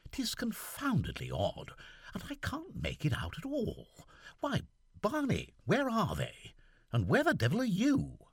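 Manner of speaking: lightly voiced young gentleman puzzled; Seaforth's voice. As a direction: fret aloud as the lieutenant.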